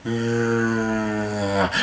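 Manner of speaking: low, quiet drawn-out "mmm" groan; a long monotone undead moan; zombie groan. Retold or imitated. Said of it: "replaced by".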